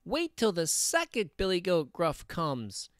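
high little billy goat; very small voice. A narrator's reading. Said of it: "in his very small voice".

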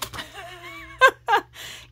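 breathy high burst of laughter. Real, not acted. Laughing at the sticker book gift, a high burst of laughter. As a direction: crack up at the joke.

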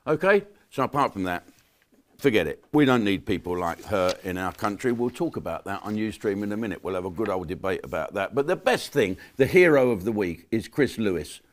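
normal talking voice

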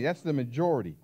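ordinary delivery

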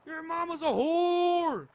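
loud, high, drawn-out yell; screamed insult. Real, not acted. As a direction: scream it, stretching the last word.

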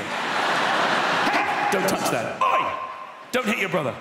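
barked "Hey" and "Oi" commands; shouting at kids. Retold or imitated. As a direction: yell quick orders at the kids. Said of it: "named them Hey and Oi".

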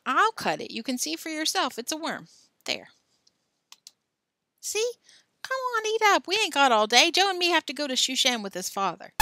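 put-on a bossy boy hurrying his friend; pushy boy voice. A narrator's reading. Alan voiced urging Billy.

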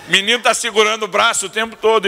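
normal talking voice

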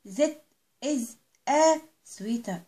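that is a sweater drawn out very slowly; slow model answer. Shown as a demonstration. Teacher drilling a child, very slow model answer. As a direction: stretch out a sweater word by word. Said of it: "يبقى هنقول".

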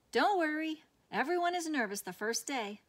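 called over shoulder. A narrator's reading. Principal calling back to Sarah while hurrying. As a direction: toss it back over your shoulder as you rush ahead. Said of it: "she said over her shoulder".

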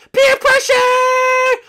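high loud drawn-out yell; shout. Acted. Pressured friend yelling slowly, high loud shout. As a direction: yell it out slow and dramatic.

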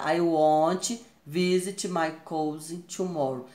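slow reading using "won't"; slow careful contracted sentence; slow contracted form. Shown as a demonstration. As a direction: contract it to "won't", slowly and clearly. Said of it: "Aqui, ó, forma contraída".

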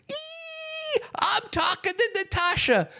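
put-on very high-pitched excited kid squeal; squealing five-year-old. Man voicing his inner five-year-old on meeting Natasha, excited squeal. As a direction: squeal like a starstruck little child. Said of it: "my inner five-year-old went".

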